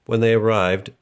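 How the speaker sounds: usual speaking voice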